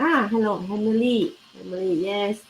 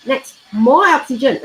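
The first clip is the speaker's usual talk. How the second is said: more punched higher and louder; stress on more; 5 semitones higher, 8 dB louder than usual. Shown as a demonstration. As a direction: mark the word more with a loud lift. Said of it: "underline more".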